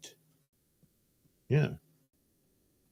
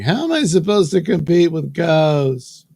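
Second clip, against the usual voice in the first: higher, louder mock plea; louder, higher put-on complaint; mock whiny wife; 4 semitones higher, 6 dB louder than usual. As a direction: whine it like the jealous wife. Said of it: "And she's like".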